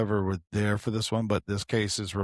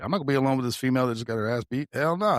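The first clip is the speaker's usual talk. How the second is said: rapid refusal; 2 semitones higher, faster than usual. Retold or imitated. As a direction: rush it out, then a sharp no. Retold inner refusal.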